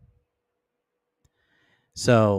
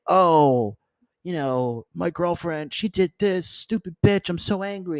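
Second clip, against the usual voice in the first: higher, mocking whine about the girlfriend; mock a guy venting to his friends; whiny complaining boyfriend; 8 semitones higher than usual. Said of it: "and start saying".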